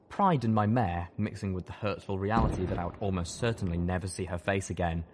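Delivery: ordinary delivery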